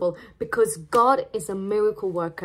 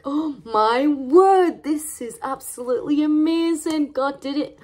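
overjoyed parents; 5 semitones higher, 3 dB louder than usual. Retold or imitated. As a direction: gush with joy like thrilled new parents. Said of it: "they were so happy, praising the Lord".

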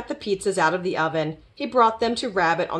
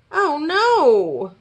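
high dismayed moan, falling away; 8 semitones higher, 4 dB louder than usual. A narrator's reading. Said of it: "moaned Rabbit".

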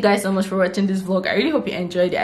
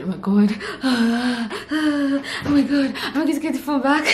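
slow sighing then higher, imitating her own panic; panicking; 6 semitones higher than usual. Telling his friend she won't get the phone back, imitated slow panicky wail. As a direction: relive the panic, sigh and wail a little. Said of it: "I was panicking".

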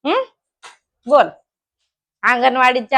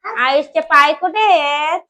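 slow sing-song with stretched final words; 4 semitones higher, slower than usual. Real, not acted. Mother quizzing her toddler on body parts, sing-song prompting.

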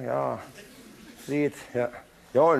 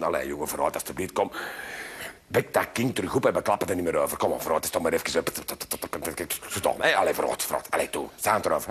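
low, soft coaxing mother; 13 semitones lower, 5 dB quieter, faster than usual. Acted. Mother coaxing son softly.

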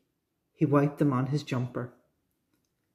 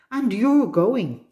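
slow, higher, mildly cross insistence; cross dad; 6 semitones higher, 4 dB louder, slower than usual. A narrator's reading. Dad ordering Danny to visit his aunt, mildly cross. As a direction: lay down the law as a storybook dad. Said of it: "Dad got cross".

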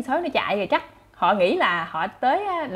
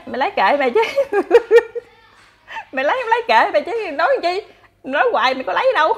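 laughing retort; 8 semitones higher, 5 dB louder than usual. Retold or imitated. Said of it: "Mọi người nói".

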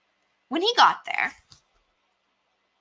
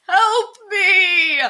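loud high sobbing shout; crying for help; 10 semitones higher, 6 dB louder than usual. Acted. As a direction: wail it to his wife. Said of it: "ran home and cried".

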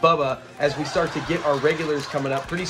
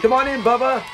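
higher, mock call to the door; 6 semitones higher, 4 dB louder than usual. Retelling the host welcoming Bubba at the door, calling out. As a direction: imitate hollering a visitor inside. Fred calls visitor in.

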